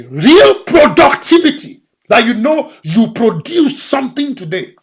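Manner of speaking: preaching. Acted. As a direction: press the point home. Preacher building loud again.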